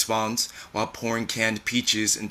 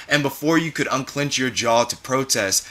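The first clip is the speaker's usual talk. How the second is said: ordinary delivery, nothing marked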